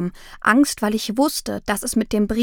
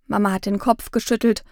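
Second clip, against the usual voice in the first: ordinary delivery, nothing marked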